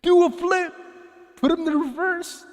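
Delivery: playful falsetto cry; high-pitched hype call. Pretend. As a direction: squeal it out, very high. Hyping up an attempted avatar flip.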